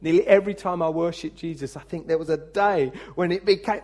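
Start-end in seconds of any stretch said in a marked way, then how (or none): none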